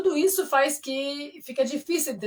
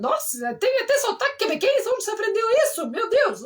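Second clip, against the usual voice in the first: high, animated amazed reaction; impressed astonishment; 10 semitones higher, 4 dB louder than usual. Retold or imitated. Retelling how Quebecers react to the accent, impressed and amazed. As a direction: imitate someone stunned and impressed, pitch leaping up. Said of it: "que eles ficam impressionados".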